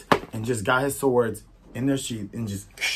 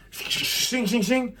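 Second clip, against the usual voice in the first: high, hissed "shing" noises; sword sound effect; 12 semitones higher, much slower than usual. Retold or imitated. Storyteller voices the three-sword attack, high metallic imitation. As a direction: imitate blades swishing, pitched high.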